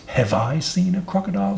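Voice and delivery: high, scornful snake voice; indignant, scornful question pitched high; dreadful scorn. A narrator's reading. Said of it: "in a voice of dreadful scorn".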